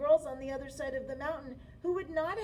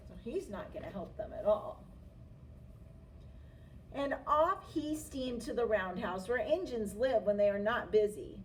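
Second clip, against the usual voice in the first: ordinary delivery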